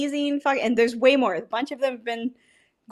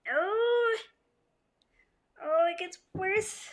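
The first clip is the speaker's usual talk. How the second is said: soft pained whimper; 9 semitones higher, 6 dB quieter, slower than usual. Real, not acted. Grimacing after the second sip.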